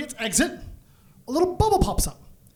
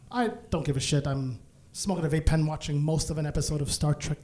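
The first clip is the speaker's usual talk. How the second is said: ordinary delivery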